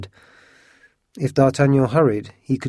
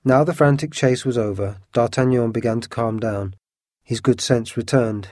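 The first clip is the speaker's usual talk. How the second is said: ordinary delivery, nothing marked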